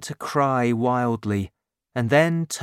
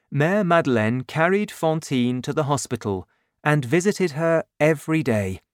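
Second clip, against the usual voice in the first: ordinary delivery, nothing marked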